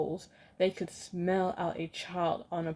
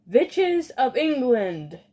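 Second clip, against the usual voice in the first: slower, louder and higher angry yell; 6 semitones higher, 8 dB louder, slower than usual. Acted. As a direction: bellow it again, stretching every word. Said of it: "she yelled".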